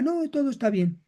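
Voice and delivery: brief soft brushing-off denial. Shown as a demonstration. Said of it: "caiga en la negación".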